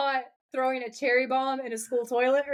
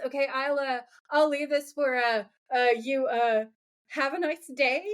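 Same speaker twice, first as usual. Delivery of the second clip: imitating a halting, sheepish goodbye. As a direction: fumble through it with lots of uhs. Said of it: "so sheepishly".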